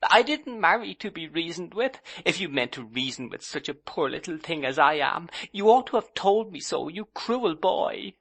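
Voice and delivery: put-on high, petulant young wife complaining; childlike bride sulking at her husband; girlish young wife. A narrator's reading. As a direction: pout at your husband in a high voice.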